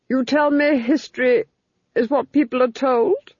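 imperious lady probing; Duchess. A narrator's reading. Duchess pressing the Fool on history, haughty.

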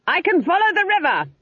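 high scream above crackling flames; very high-pitched, straining call. A narrator's reading. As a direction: scream it to carry over the noise. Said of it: "Granny Weatherwax screamed above the crackle of flames".

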